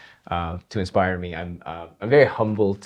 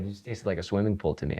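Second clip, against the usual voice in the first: normal talking voice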